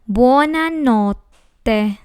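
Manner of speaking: "buonanotte" stretched very slowly, bwo-na-NOT-te; very slow model. Shown as a demonstration. Teacher models buonanotte for learners, very slow. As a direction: drag each syllable out clearly. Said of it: "how to pronounce these phrases correctly".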